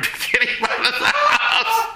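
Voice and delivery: real laughing through the end of a joke; laughing punchline. Real, not acted. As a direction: break into laughter mid-sentence. Breaks up at joke's punchline.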